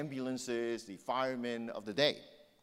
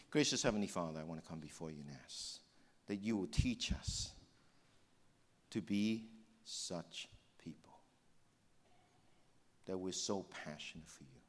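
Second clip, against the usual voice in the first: prayer; 2 semitones lower than usual. Real, not acted. Leads a prayer.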